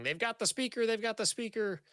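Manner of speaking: high, urgent shout of alarm. Acted. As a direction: call out the alarm.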